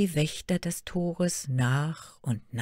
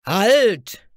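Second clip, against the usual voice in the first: loud, higher sentry's challenge; rough shout; 6 semitones higher, 11 dB louder than usual. A narrator's reading. Guard challenges a newcomer at the gate, rough shout. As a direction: bark it out as a rough gatekeeper. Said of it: "rief da eine rauhe Stimme".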